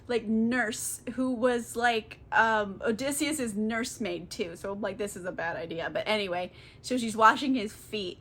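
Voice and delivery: usual speaking voice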